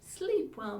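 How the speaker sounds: bedtime rhyme. Shown as a demonstration. Rhyme repeated.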